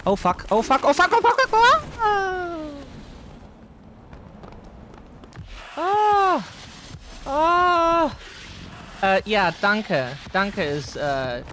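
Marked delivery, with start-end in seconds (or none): 0.1-1.8 rising panic, from mutter to high yell; Oh, fuck building louder and higher each time; panicking. Real, not acted. Player spotting the creature, building panicked yell. 2.0-8.2 high frightened scream; scared scream. Real, not acted. Player attacked by the creature, high scream.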